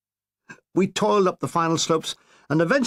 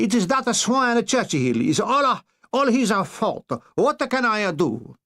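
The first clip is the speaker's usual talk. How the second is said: slow, higher Italian wail; mock Italian lament; 5 semitones higher, slower than usual. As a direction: moan it in a hammed-up Italian accent. Mussolini voiced, slow lament.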